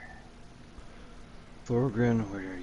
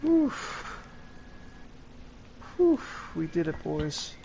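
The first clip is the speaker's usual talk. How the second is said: relieved sigh; 10 semitones higher, slower than usual. Real, not acted. Relief after escaping.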